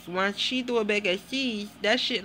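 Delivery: ordinary talk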